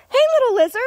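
very high, loud greeting; pet voice. Real, not acted. Gardener spotting a little lizard, very high loud greeting. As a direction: squeal hello to a small creature.

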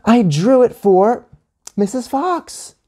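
put-on high, slow, drawn-out kid yell; stretched high-pitched protest. A narrator's reading. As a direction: wail it out like an insistent child. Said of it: "Anya yelled".

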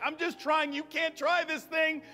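fervent preaching. Real, not acted. Preacher telling a missionary's testimony to the congregation, fervent preaching. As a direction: preach with rising urgency.

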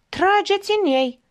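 high-pitched yelled order; acted yell. A narrator's reading. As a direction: yell the command, pitch leaping up. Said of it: "urlă". (ordin urlat, pe ton înalt)

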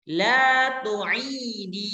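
La tu'idi drawn out very slowly, syllable by syllable; slow model form. Shown as a demonstration. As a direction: stretch La tu'idi slowly so students hear the final i. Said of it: "teacher models feminine form". (La tu'idi diucapkan sangat pelan dan panjang)

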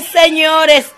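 normal talking voice

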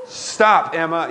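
mock angry snap at a daughter; snapping in anger. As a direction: bark her name like a fed-up dad. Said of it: "it's like anger".

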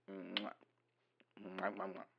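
soft smooches into the phone; kissing sounds. Real, not acted. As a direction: blow a string of quiet kisses. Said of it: "I'm kissing the phone".

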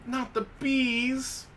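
movie quote. Retold or imitated. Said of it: "The best movie quote ever".

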